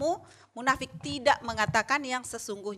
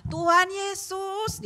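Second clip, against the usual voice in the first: loud prayer; 8 semitones higher, much slower than usual. Retold or imitated. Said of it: "dia kencang suaranya".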